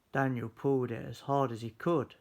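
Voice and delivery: usual speaking voice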